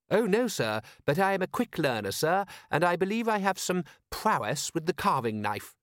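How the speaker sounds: lightly voiced proper butler; a hint of stiff, eager manservant; prim butler voice. Butler character voice.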